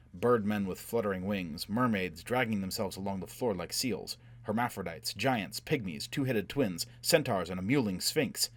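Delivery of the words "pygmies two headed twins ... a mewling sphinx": usual speaking voice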